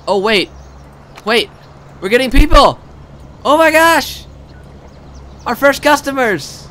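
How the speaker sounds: high, loud, elated exclaiming; thrilled excitement. Real, not acted. Player seeing the park's first customers arrive, thrilled. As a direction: cry out in delighted amazement.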